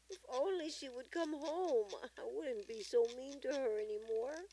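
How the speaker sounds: high, loud wishful plea; story character wishing hard, pitched high; grandmother's earnest regret. A narrator's reading. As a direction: voice her regret high and pleading. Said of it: "she assured Tumbi".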